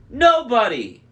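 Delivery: loud, high taunting cry; yelling. A narrator's reading. Annabeth yells her fake name at the Cyclops. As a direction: shout it out to bait the giant. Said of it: "Annabeth yelled".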